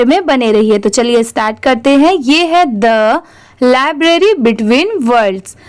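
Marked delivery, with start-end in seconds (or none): 2.8-5.6 very slow, word by word, for learners to copy; teacher reading the title very slowly; slow read-aloud. Shown as a demonstration. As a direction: read it out slowly so they can repeat. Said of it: "मेरे बाद आप वो ज़रूर repeat करिए".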